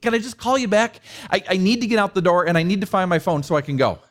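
rushed, fast plea to hang up; flustered husband rushing off the phone; harried. Retold or imitated. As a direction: blurt it out in a hurry. Frantic man cutting call short.

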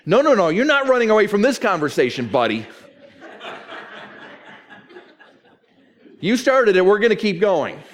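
high, loud, hammed-up refusal; mock confrontational. Someone refusing the preacher's timeout, mock combative.

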